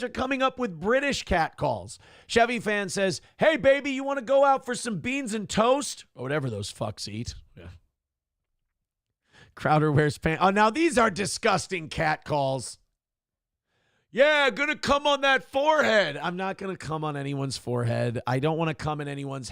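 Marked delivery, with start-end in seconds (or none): 3.4-6.1 high-pitched hollered come-on; catcall. Acted. Reads out British catcall. 14.1-16.2 high, hollered crude come-on; crude catcall. Acted.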